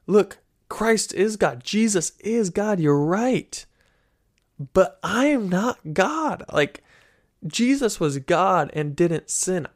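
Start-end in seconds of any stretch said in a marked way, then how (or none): 0.1-6.4 slow, higher, deliberate insistence on "not"; emphatic answer. Retold or imitated. Replying to a New Ager, slow emphatic insistence. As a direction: plant each claim firmly. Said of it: "Where I would say".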